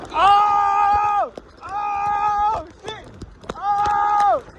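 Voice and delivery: high, drawn-out alarmed "oh"; alarmed cries. Real, not acted. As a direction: cry out high in real alarm. Horse ride into deepening water.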